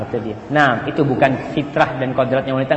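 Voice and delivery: ordinary delivery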